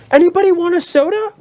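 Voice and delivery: high sing-song offer; chirpy lady. Retold or imitated. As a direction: chirp it like a pushy hostess. Voicing the closing lady.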